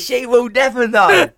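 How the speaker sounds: loud, high, slow mock triumph; gleeful secrecy. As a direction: draw out a hammed-up gloat. Acting out his gleeful secret.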